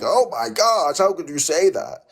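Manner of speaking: high-pitched mock-scandalized reaction; exaggerated pearl-clutching in a high voice; mock outrage. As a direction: imitate a shocked, offended crowd. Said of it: "everyone's like".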